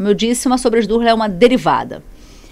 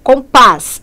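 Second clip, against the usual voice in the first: Compás with final PÁS stressed, loud; stress on last syllable; 3 semitones higher, 7 dB louder than usual. Shown as a demonstration. Teacher showing the tonic syllable, Compás stressed on PÁS. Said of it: "A sílaba tônica tá aqui".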